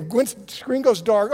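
ordinary talk, nothing marked

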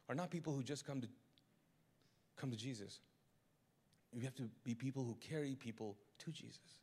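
soft, low, sincere appeal; quiet heartfelt sermon close; earnest hushed preaching. Acted. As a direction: lean in and plead gently.

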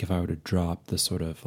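usual speaking voice

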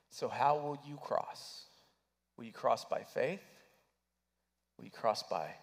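ordinary talk, nothing marked

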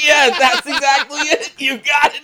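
high, loud goofy dumb-guy voice. Retold or imitated. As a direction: play the dopey echoing sidekick. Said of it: "repeats it in a dumb voice".

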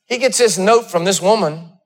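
building sermon cadence, rising and pushing; preaching. Acted. Pastor preaching to his congregation, rising fervor. Preacher telling Elijah story.

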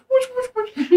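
high squealing tape rewind; rewind noise. Pretend. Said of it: "best rewind noise I've ever heard".

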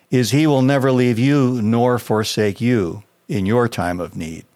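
ordinary talk

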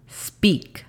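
ordinary delivery

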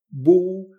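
bu with a hard B at the start; bu erróneo. Shown as a demonstration. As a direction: start bu on closed lips. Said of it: "no se pronuncia". (bu con B inicial, mal pronunciado)

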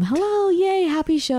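high-pitched, cheery show welcome; excited greeting. Real, not acted. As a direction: squeal a happy hello. Bubbly welcome opening the show.

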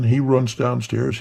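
ordinary delivery

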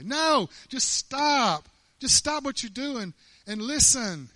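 loud, drawn-out insistence; emphatic command. Real, not acted. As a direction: stop them short, loudly. Preacher cuts off the false idea forcefully.

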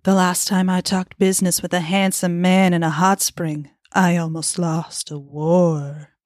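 imitating a sultry purr; slow seductive line read. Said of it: "in her just most sultry voice".

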